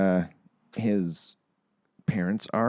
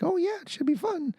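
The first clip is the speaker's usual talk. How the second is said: high mock parent; 4 dB quieter than usual. Parents quoted.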